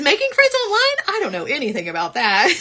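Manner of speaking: high, laughing, sarcastic read; Wanda's sarcasm. Retold or imitated. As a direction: read the comment dripping with sarcasm. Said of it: "That's sarcasm".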